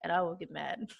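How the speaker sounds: softly spoken playful threat; mock threat. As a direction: threaten jokingly under your breath.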